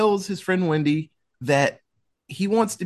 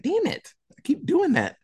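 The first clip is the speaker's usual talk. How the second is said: squeaky high exasperated whine; high, fed-up groan at her own bad aim; frustrated [name]; 14 semitones higher than usual. [name] scolding herself after missing again, high exasperated whine.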